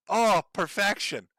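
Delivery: ordinary delivery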